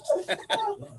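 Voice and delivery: short high falling giggle; amused little laugh. Real, not acted. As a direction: giggle it away. Laughing at the story.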